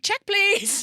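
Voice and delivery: high, loud call for the bill; exaggerated high-pitched plea to the waiter; mock urgent call. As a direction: call out in comic desperation to leave. Mimicking diner desperate to leave.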